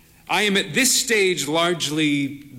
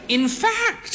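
put-on higher, slow voice of a proud frail old lady; frail old mother. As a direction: play the bird-faced old mother, cautious. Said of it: "she said".

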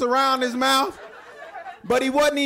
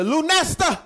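yelling out the window; 3 semitones higher than usual. Retold or imitated. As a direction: holler names out a window at the kids. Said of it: "She always yelling at her out, out the window".

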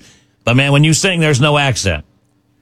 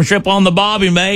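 higher-pitched mock Aussie accent; Australian accent; 7 semitones higher than usual. Host imitating the cliché Aussie greeting to an Australian singer, mock Australian accent. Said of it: "tried to do the, uh, Australian thing".

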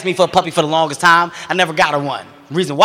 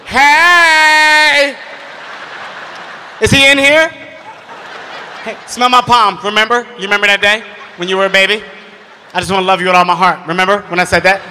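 nervous announcing. Retold or imitated. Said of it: "gotta announce myself".

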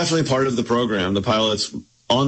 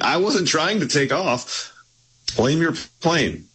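higher, slow protest without real shouting; mock yell back; 6 semitones higher, slower than usual. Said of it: "I think Tony yelled back".

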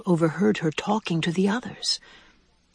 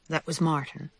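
plain reading voice

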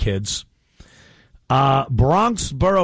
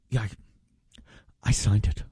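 lower, softer mock governor; sheepish mumble; 8 semitones lower, 5 dB quieter, faster than usual. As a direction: admit it quietly, caught out.

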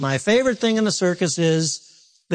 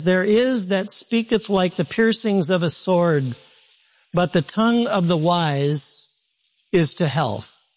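slow, measured reading of the verse with pauses; slow scripture reading; slower than usual. Shown as a demonstration. Pastor reading Proverbs to the congregation, slow and deliberate. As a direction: let each phrase of the verse land slowly. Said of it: "pastor reads verse slowly first".

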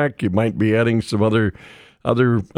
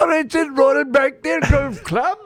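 very high, put-on Liverpool accent; attempted Liverpool accent; 14 semitones higher than usual. Shown as a demonstration. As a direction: have a go at sounding Liverpudlian, pitched way up. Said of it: "Maybe try Liverpool, like you're one of the [name]".

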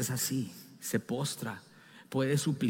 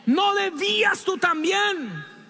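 said loud; 4 dB louder, slower than usual. Shown as a demonstration. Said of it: "quiero que repita eso fuerte".

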